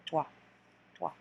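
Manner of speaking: trois said twa; French trois. Shown as a demonstration. Teacher repeating three for the class: trois as twa. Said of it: "Trois, like you're saying".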